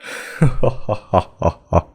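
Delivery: slow, gloating chuckle; stage villain's amused laugh. Acted. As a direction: chuckle in separate, falling beats. Villain laughs at his bride.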